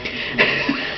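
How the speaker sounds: soft unvoiced chuckle; breathy laugh. Real, not acted. Reader laughing at the kid's antics. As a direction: laugh quietly to yourself.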